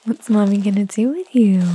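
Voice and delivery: ordinary talk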